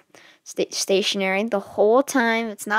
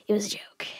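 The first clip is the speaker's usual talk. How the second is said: whispering; 8 dB quieter, faster than usual. Acted. Whispered aside.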